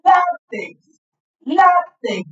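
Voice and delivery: teacher stressing Látex for the class; stressed first syllable. Shown as a demonstration. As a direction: punch LÁ in Látex. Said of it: "¿Cómo la voy a pronunciar?".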